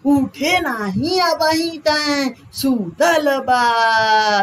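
a mum hollering at her sleeping son, vowels drawn long; mother calling out. Retold or imitated. Mother calling to her son who is still asleep, drawn-out Bhojpuri call. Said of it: "mummy चिल्लाती होंगी".

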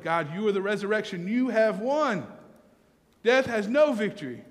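triumphant prayer. Real, not acted. Prayer rises to triumphant proclamation.

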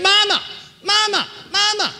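very high, loud calls to rouse her; hollering. Retold or imitated. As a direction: shout right into an unconscious mother's ear. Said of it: "Hollered a little louder".

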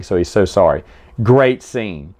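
ordinary talk, nothing marked

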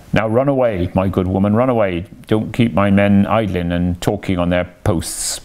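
usual speaking voice

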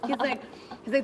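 ordinary talk, nothing marked

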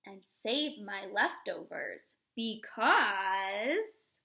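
slow, expressive storybook read-aloud; reading to children. Acted. Teacher reading a picture book to children, expressive read-aloud. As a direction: read it warmly to a class of little kids.

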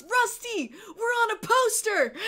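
high-pitched imitation of a thrilled player calling his teammate; excited calling out. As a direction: call over to a teammate with delight.

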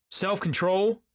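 high, loud rising echo of disbelief; incredulous. A narrator's reading. Tom repeating his wife's word back, incredulous. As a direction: throw it back as a disbelieving question, voice leaping up. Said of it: "repeated Tom incredulously".

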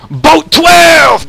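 high, loud, stretched-out holler; exuberant shout. Acted. Player hollering the number out mid-game, exuberant shout. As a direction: shout it high and long, full volume.

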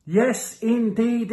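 usual speaking voice

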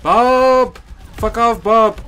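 loud, high yell at the enemy; furious shout. Real, not acted. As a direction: scream at him to back off. Yelling at enemy.

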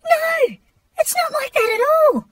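disgusted shriek. Real, not acted.

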